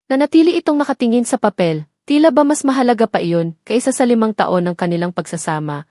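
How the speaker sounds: usual speaking voice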